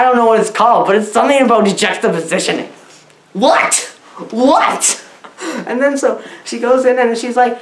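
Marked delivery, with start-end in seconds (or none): none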